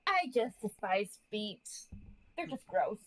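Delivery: ordinary talk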